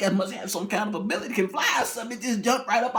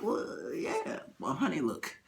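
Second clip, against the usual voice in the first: hushed warning; 4 semitones lower, 9 dB quieter than usual. Acted. Husband whispering.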